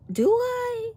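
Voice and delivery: high, playful innocent question; coy mock surprise. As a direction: act like you had no idea. Coy reply to coworker.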